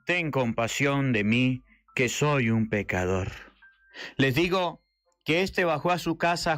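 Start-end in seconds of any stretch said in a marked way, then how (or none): 0.0-3.3 low, humble plea for mercy; humble tax collector. Acted. The tax collector begging God for mercy, humbly. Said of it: "se golpeaba el pecho diciendo".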